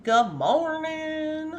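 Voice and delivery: very slow, high, stretched-out hello; drawn-out greeting. Real, not acted. Streamer greeting viewers as they arrive, drawn-out call. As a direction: stretch each syllable out long and high.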